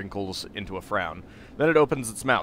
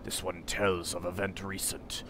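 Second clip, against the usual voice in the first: solemn stranger; 4 semitones lower, 9 dB quieter than usual. Acted. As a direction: speak as a grave foreigner. Voices Porphyron.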